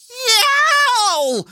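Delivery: very high drawn-out howl; long high yowl. A narrator's reading. Hare wakes behind the leader, long high yowl. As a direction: let out a piercing yowl from far behind. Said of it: "heard a yowl from behind".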